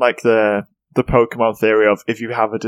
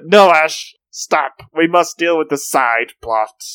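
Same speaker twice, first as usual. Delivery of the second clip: mock Misty protest; 5 semitones higher, 4 dB louder, slower than usual. Misty voiced in recap.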